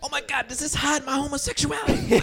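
high-pitched mock celebrity voice; hammed-up squeaky star impression; John Travolta. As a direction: ask it in a silly high caricature. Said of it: "I'm John Travolta".

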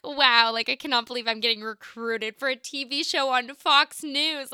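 loud, thrilled disbelief; excited. Retold or imitated. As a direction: gush about being recruited for TV. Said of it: "I'm just getting excited at this point".

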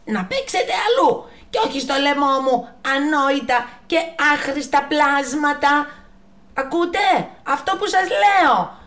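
high, loud furious scolding; angrier. Acted. The giraffe scolding the monkeys, high, loud and furious. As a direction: tell them off even harder. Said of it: "θύμωσε ακόμα πιο πολύ".

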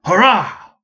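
loud, high cheer; crowd cheer. Acted. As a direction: throw the cheer back at full voice. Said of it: "at the top of our lungs".

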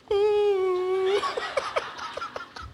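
long, high mock wail; high-pitched scream. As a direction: imitate a drawn-out prophetic shriek that trails away. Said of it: "always with a high-pitched scream".

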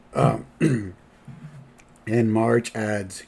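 usual speaking voice

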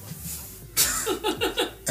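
breathy high laugh. Real, not acted. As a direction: burst out laughing. Laughing at own intro.

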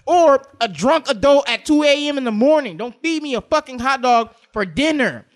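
loud, high ranting yell about hot dogs; mock angry yelling. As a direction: yell it like you're truly offended. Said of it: "Wait, did I just yell?".

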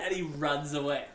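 ordinary delivery

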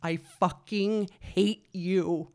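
slow, drawn-out mock scream of hatred; retold scream. Rider cursing through the whole ride, retold scream. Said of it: "I guess I screamed the whole time".

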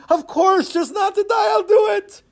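very high, frantic squeal; desperate agreement. Acted. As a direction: agree frantically to save your life. Frightened man agrees desperately.

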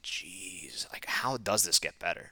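hushed, whispered disbelief; dismayed whisper. Retold or imitated. Reacting to himself as he looks at the mess, dismayed whisper.

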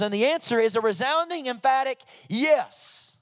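emphatic pulpit proclamation; full-force preaching. Real, not acted. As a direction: preach it hard, building to a resounding finish.

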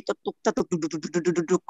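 low, soft run of thuds; pounding heartbeat imitation. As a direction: mimic a heart that will not stop. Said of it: "Itu jantung kayak nggak". (tiruan detak jantung, rendah dan pelan)

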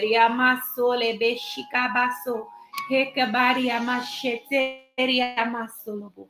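fluent tongues in prayer; speaking in tongues. Acted. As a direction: let the tongues flow out. Praying in tongues mid prayer.

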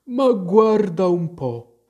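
affronted sleepy-creature voice; indignant. A narrator's reading. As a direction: huff it out, dragging the words. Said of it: "disse il Ghiro indignato".

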